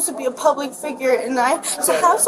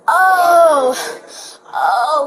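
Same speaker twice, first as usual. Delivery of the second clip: mock overreacting; 3 semitones higher, 5 dB louder than usual. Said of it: "like, overreacting".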